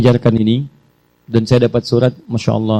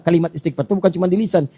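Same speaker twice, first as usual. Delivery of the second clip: ordinary talk, nothing marked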